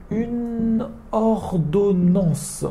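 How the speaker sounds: slow, careful "une ordonnance"; slow model word. Shown as a demonstration. A teacher introduces "une ordonnance" slowly to students. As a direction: draw out "une ordonnance" for learners. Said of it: "Après".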